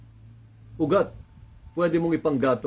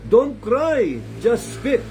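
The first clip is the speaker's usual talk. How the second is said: higher, louder preaching push; urging exhortation; 6 semitones higher, 3 dB louder, much slower than usual. Real, not acted. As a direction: drive the point home to the crowd. (masigasig na pangangaral)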